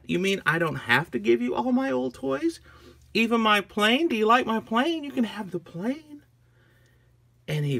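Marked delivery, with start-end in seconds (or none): 0.0-6.2 high, disbelieving questions; amazed little girl. Acted. Agnes offering minion her plane, high and amazed. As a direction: ask eagerly in a raised child voice.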